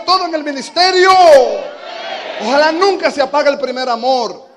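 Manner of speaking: loud, high fervent exhortation; preaching at full force. Acted. Preacher urging the church to keep crying out. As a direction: urge them on with fire.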